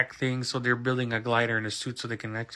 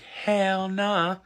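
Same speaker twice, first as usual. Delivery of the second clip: high, drawn-out refusal; Spider-Man; 8 semitones higher than usual. Retold or imitated. Hero turning down the villain's offer, high and emphatic. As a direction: stretch out a mock-heroic no. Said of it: "He's like".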